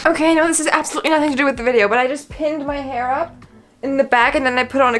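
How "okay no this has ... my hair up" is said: very fast tumbling aside; rushed aside. Real, not acted. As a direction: rattle it off. Quick aside.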